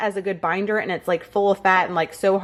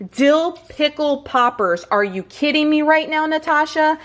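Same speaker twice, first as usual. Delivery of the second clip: excited disbelief; 6 semitones higher than usual. Real, not acted. Thrilled reading recipe list.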